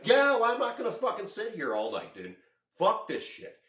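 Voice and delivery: high, loud, indignant pushback; reenacted defiant outburst; adamant refusal. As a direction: refuse outright, voice jumping up. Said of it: "so fucking adamant about it".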